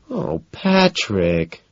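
slow, drawn-out whine; whiny drawn-out plea. A narrator's reading. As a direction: drag it out, complaining. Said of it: "she whines".

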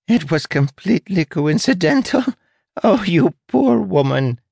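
very high, squeaky rat voice; squeaky mocking laughter. A narrator's reading. Rat mocking a fellow prisoner's bad luck, squeaky and very high. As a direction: squeak it out as if tickled by the misfortune. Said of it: "high-pitched series of squeaks".

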